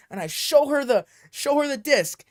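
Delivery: ordinary delivery, nothing marked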